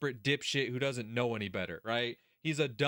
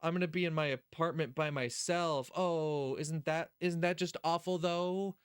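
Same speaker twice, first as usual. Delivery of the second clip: mock whining; 3 semitones higher than usual. Streamer mimics a character's self-pitying thoughts, mock whining. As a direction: moan it in mock self-pity. Mocking a whiny inner monologue.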